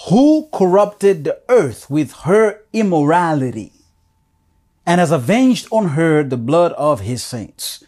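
fervent scripture reading. Acted. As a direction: proclaim the verses to the congregation.

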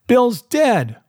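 high call from inside a house; shouting. A narrator's reading. Man shouting from the house to those outside, high shout. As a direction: cry the news out high. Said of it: "a voice shouting from the house".

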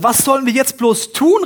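loud, high, panicked question; desperate cry. Acted. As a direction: plead helplessly for what to do. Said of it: "rief er". (verzweifelt, laut und hoch gefragt)

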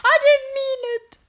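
very high, loud, desperate squeal; miserable culprit crying. A narrator's reading. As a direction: wail a frantic excuse. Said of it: "cried the miserable culprit".

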